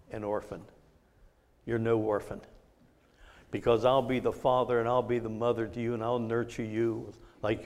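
low, soft, comforting voice of God; tender divine reassurance. Retold or imitated. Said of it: "that verse came like a dart from heaven".

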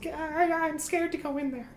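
high, soft frightened voice; timid creature whimpers; scared creature. Acted. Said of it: "he says".

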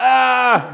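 loud angry yell of outrage; enraged yell. Retold or imitated. Johnny smashing things in the house, furious yell. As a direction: bellow it in a rage. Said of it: "he goes".

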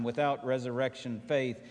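ordinary talk, nothing marked

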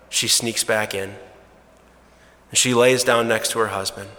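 usual speaking voice

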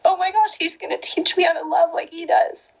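getting emotional. Real, not acted. Telling viewers about God's love, tearfully emotional. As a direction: let the emotion rise into the words. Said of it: "Sorry, I'm getting emotional".

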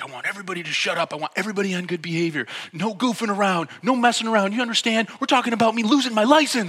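high, rising panic as a retold rant; fear-struck, furious. Driver warning passengers as his license is at stake, frantic. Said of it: "He's just fear-struck, and he's furious".

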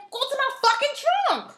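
high, loud snap at the cop; hammed-up fury; mock angry yell. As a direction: shout it back at the cop. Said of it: "The cop will be like".

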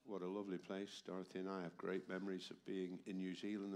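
normal talking voice

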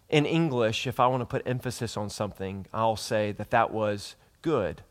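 ordinary talk

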